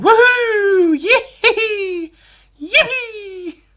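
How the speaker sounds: high, loud laughing whoops; gleeful whoops. Retold or imitated. Comedian imitating himself whooping at the mirror. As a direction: whoop like a giddy kid.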